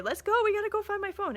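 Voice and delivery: high, fast, frantic imitation of someone freaking out; hammed-up hurry to find the phone; mock panic. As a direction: rattle it off like a panicking stranger. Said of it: "a lot of people would just be freaking out".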